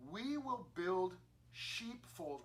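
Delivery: the tribes' offer, read slowly; slow deliberate reading. Acted. Reuben and Gad slowly laying out their offer to Moses. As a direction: spell out the plan slowly.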